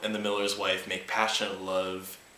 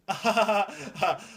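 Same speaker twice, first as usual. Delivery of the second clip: high giggle; high laugh; 12 semitones higher, 4 dB louder than usual. Real, not acted. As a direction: let out a giggle. Laugh after tale.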